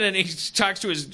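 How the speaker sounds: ordinary delivery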